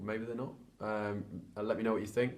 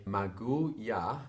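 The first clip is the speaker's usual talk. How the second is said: slow Russian attempt; much slower than usual. Shown as a demonstration. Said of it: "so you can say".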